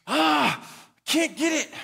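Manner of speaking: put-on high whiny cry of a boy who can't manage; frustrated little boy. Dad imitating his son stuck on a toy, high frustrated whine.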